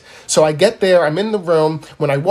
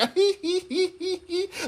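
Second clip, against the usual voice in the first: breathy high-pitched giggle; soft high tittering laugh; 9 semitones higher, 9 dB quieter than usual. Retold or imitated. Imitating giggling grandma.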